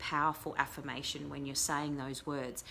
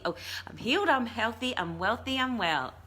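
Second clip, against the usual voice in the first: higher and louder, declaring it with joy; excited affirmation; 6 semitones higher, 4 dB louder than usual. Shown as a demonstration. Host repeating a viewer's affirmation, excited and louder. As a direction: proclaim it like you already believe it.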